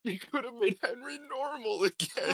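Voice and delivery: high mock crying wail. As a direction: whine it like a fake sob. Mock sad wail from player.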